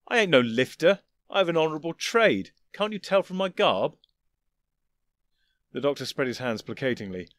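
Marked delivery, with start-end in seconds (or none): 0.1-4.0 offended. Acted. Lael offended.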